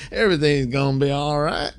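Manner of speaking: relaxed country accent impression. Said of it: "he really falls into his country accent".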